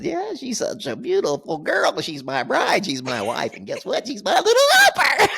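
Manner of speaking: high, sugary bragging voice; showing off his bride, high and doting; mock proud husband. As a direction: gush about her like a proud groom. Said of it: "he goes".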